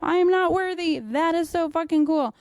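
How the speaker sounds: gushing admiration, hammed up; awed. Admiring gym people who know jujitsu, mock awe. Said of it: "I'm like".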